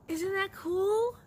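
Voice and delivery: high, loud, slow wonder; excited. Real, not acted. Showing viewers the magazine patterns, high slow excitement. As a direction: marvel out loud at the find.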